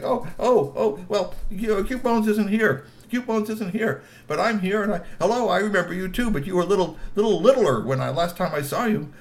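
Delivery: put-on flustered troll boy. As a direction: soothe her while flustered. Strongbones answers gently.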